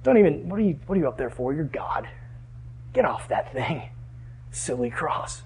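low, hushed tempter's voice; imitated devilish persuasion, dropping low and quiet; Satan. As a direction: coax softly, belittling it all. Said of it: "You can hear Satan".

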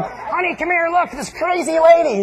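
high, urgent mock call; gawking neighbor. Neighbor calling honey to watch mom, high mock call. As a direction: summon honey to see the spectacle. Neighbor calls wife to look.

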